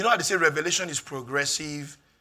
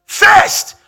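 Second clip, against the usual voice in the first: shouted, much higher and louder sermon peak; full-force preaching; 6 semitones higher, 12 dB louder than usual. Acted. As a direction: preach it at the top of your voice.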